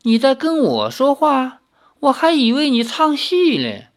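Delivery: 嘲弄的高声调侃; 轻佻地逗弄太守; 笑眯眯. A narrator's reading. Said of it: "姬三娘笑眯眯地说". (女贼笑眯眯地调侃太守)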